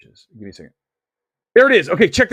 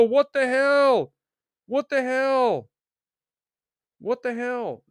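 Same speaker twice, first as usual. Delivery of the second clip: slow, higher "What the hell", drawn out; stunned disbelief; 8 semitones higher, slower than usual. Retold or imitated. Viewer spotting two suns, slow stunned disbelief. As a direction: gape at the picture in slow disbelief. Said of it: "you go".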